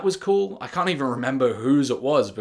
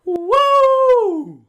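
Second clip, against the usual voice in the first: hammed-up whoop; 11 dB louder than usual. Mock celebration after joke.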